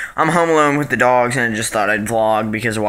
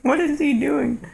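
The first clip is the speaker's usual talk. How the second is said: pet talk. Real, not acted. Watching her dog.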